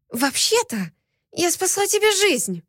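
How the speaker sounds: indignant whisper. A narrator's reading. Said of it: "возмущённо прошептала я".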